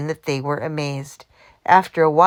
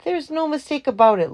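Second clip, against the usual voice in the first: calling back; 9 semitones higher than usual. A narrator's reading. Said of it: "Louis called back over his shoulder".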